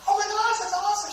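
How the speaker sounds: very high, giddy retold reaction; high-pitched mock gush of delight. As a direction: squeal it lightly as a retold reply. Acts out own delighted reply.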